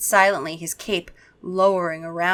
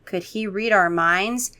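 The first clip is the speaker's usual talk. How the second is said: ordinary delivery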